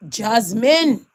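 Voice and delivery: ordinary talk, nothing marked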